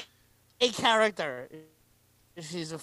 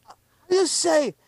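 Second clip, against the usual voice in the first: a higher put-on Spanish accent; mock Spanish accent; 6 semitones higher than usual. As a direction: parody her asking for the word. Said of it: "thick Spanish accent".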